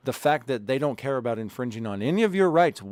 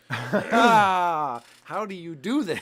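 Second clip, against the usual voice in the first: laughing; 4 semitones higher, much slower than usual. Real, not acted. Laughs at co-host's tech trouble.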